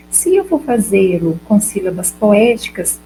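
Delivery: ordinary talk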